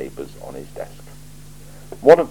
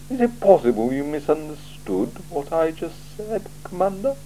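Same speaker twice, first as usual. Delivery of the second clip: a cool ruler weighing every word; icy, deliberate interrogator; Lord Vetinari; 12 semitones higher than usual. Acted. Vetinari presses Vimes.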